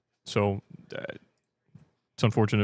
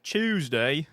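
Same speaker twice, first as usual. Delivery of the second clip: higher British "Tuesday", starting with "choose"; mock British; 5 semitones higher, 3 dB louder than usual. Streamer repeating a British trope, mock British. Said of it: "make fun of us for speaking like that".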